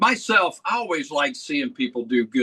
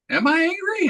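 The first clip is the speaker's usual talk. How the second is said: high, startled "Am I angry?"; retold disbelief, voice shooting up; mock surprised. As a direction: act caught off guard, pitch jumping up. His own surprised reply, retold.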